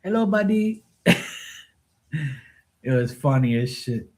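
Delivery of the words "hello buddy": put-on high, polite Filipino-accented "sir" voice; imitating Filipino pool guy. Pool cleaner greeting Mark politely, in a high Filipino-accented voice. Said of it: "he still called her Buddy".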